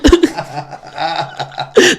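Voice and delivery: rising burst of laughter; loud laugh. Real, not acted. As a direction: crack up. Laughing at his own bit.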